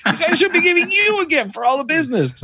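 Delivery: French mechanic protesting about the gift; French accent. Retold or imitated. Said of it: "Are we doing accents?".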